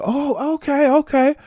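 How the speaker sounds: high, light okays; naive okay. Retold or imitated. Newcomer naively reassured, high and light. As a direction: sound easily convinced.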